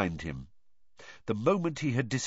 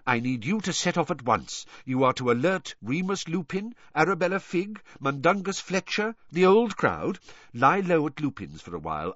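higher-pitched, measured leader giving instructions; purposeful headmaster, pitched up; commanding old wizard; 6 semitones higher than usual. Acted. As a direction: lay out the orders in a lifted voice.

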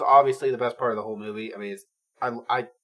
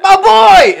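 very loud, high, drawn-out whoop; delighted cheer; 16 dB louder than usual. Retold or imitated. Reenacting spotting his favourite actor in the film, ecstatic shout. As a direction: holler it like a proud superfan.

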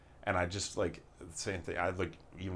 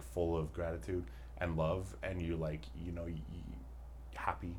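normal talking voice

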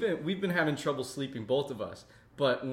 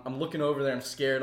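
ordinary talk, nothing marked

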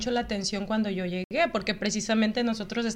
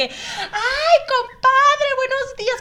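much higher, singsong greeting to a buddy; chirpy Mexican greeting; 8 semitones higher, 5 dB louder than usual. Retold or imitated. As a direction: greet a friend on the bus. Mock Mexican bus greeting.

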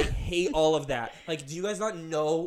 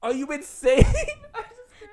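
incredulous outburst; 10 dB louder, slower than usual. Real, not acted. Incredulous outburst then laugh.

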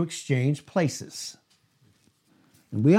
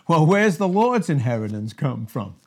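ordinary delivery

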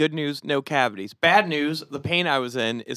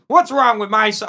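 loud, high angry father; angry dad; 7 semitones higher, 7 dB louder than usual. Pretend. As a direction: yell it as a furious dad. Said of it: "pretend to be your father".

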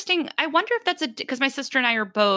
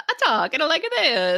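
higher-pitched, hammed-up Italian accent; 7 semitones higher than usual. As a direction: put on a caricature Italian accent. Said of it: "I can do the accent".

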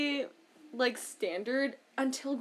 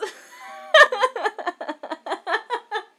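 loud laugh; 11 semitones higher, 10 dB louder than usual. Real, not acted. Cohost laughing hard at Greg's state puns. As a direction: burst of high loud laughter.

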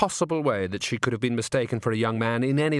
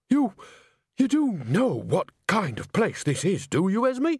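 wary disbelief; 8 semitones higher than usual. A narrator's reading. Nanny uneasy.

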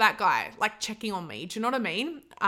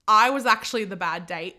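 ordinary delivery

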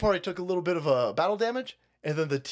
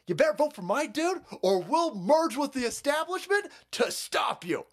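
ordinary delivery

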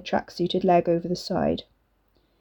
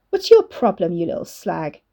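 snarling. A narrator's reading. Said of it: "morphed into a snarl".

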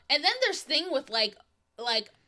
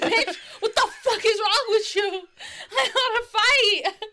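higher, louder, laughing indignation; mock outrage; 9 semitones higher, 4 dB louder than usual. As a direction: play fed up and ready to fight. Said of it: "a bitch is like".

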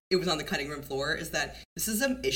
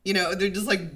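ordinary talk

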